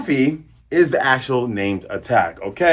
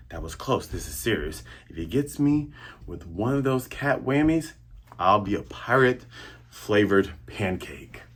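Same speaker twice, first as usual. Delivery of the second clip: Zoro's inner voice; 5 semitones lower, 10 dB quieter than usual. Retold or imitated. Zoro voiced after close call.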